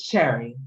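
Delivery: "Cherry" begun with a CH sound; correcting pronunciation. Shown as a demonstration. Guest telling host her surname, "Cherry" with a CH. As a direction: say "Cherry" clearly with CH as in chair. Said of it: "did I pronounce your last name correctly".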